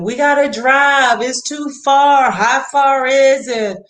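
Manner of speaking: put-on high, loud whiny kid; mock kid complaining about the drive; imitating complaining kids. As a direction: whine it out like a bored child in the car. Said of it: "I hear more complaining".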